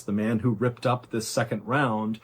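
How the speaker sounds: usual speaking voice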